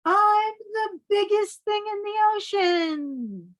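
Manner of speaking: high, grand bragging squid voice; triumphant squid boast. Acted. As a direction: crow it to the whole ocean.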